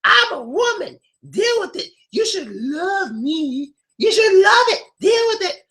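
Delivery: high, shouted mock of a tough woman; bossy woman. Teacher mimics women telling men to deal with it, high mock shout. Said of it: "But a lot of them just go".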